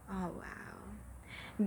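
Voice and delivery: whispered awe; hushed, amazed wow; breathless whisper. Real, not acted. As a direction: whisper it in wonder. Awe at divine sign.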